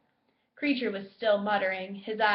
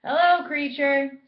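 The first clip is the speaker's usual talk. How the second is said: loud, higher mock-cheery hello; very loud greeting; 6 semitones higher, 9 dB louder than usual. A narrator's reading. Fred greeting the house elf loudly. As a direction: announce yourself loudly to startle him. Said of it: "said Fred very loudly".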